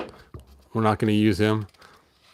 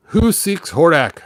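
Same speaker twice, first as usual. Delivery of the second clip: cold voice; 5 semitones higher, 5 dB louder, slower than usual. A narrator's reading. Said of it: "a cold voice asked".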